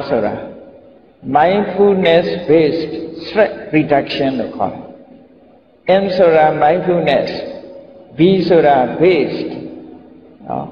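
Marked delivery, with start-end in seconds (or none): 1.4-9.5 slowly spelling out the English acronym. Shown as a demonstration. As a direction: say each English word very slowly and clearly, like dictating. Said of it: "M B S R လို့နာမည်ပေးထားတယ်".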